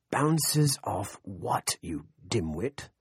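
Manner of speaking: slow, drawn-out indignant question; exasperated demand. A narrator's reading. Thurston to the man explaining the missile, exasperated demand. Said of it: "Thurston demanded".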